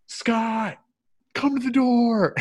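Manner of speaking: high, drawn-out summons; high singsong mock call; imitated calling out. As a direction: call someone from another room in mimicry. Imitating mother calling someone over.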